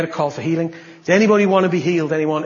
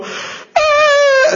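high thrilled cry, much higher; joyful cry; 9 dB louder than usual. Retold or imitated. Healed woman on stage, overcome, cries out. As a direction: squeal of overwhelmed joy.

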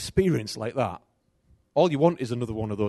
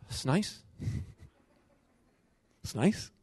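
soft, indifferent murmur; mild, unbothered that's nice; mum's flat reply; 3 semitones higher, 8 dB quieter, slower than usual. Retold or imitated. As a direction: son voicing his mother's calm indifference. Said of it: "She'd go".

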